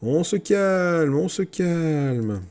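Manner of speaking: high, long-stretched words falling at the end; calm-down call. Real, not acted. Player telling his troops to settle down, drawn-out high call. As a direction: draw each phrase right out, high-pitched.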